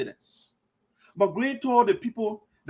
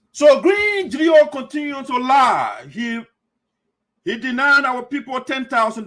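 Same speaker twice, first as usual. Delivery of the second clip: loud, slow, higher outraged shout; furious shout; 5 semitones higher, 6 dB louder, slower than usual. Real, not acted. Host denouncing Green to viewers, shouting slowly. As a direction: hammer each word out in anger. Ranting against Green's lies.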